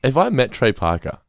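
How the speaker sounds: normal talking voice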